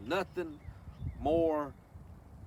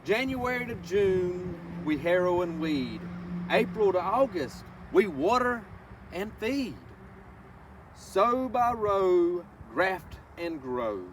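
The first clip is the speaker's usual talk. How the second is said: slow, lilting read-aloud of a rhyming book; reading to children; 5 semitones higher, 4 dB louder, slower than usual. Acted. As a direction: savor each word for the little ones. Reads picture book to children.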